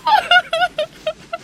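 high crying sound; girl's sobbing burst. A narrator's reading. Girl on the train, bursting into tears. Roberta starts to cry.

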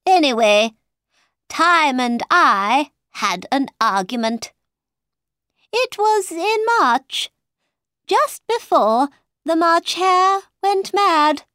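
high, animated Mad Hatter; Mad Hatter storytelling. A narrator's reading. Mad Hatter explaining his argument with Time, theatrically.

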